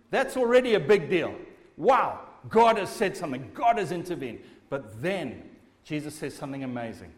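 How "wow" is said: usual speaking voice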